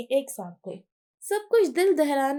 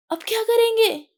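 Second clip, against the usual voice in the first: panicked question; 8 semitones higher, 8 dB louder than usual. A narrator's reading. Girl panics, phone broken.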